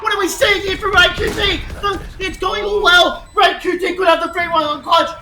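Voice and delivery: loud disbelieving yelling; excited shouting. Real, not acted. Caster yelling at a string of headshots. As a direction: scream in disbelief at the play.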